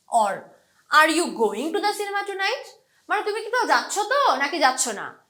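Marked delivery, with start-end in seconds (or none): 1.0-2.6 "going" lifted high, question rising at the end; stress on GOING. Shown as a demonstration. Teacher asks whether you are going or not, stress on "going". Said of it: "going টাতে আমি stress দিচ্ছি".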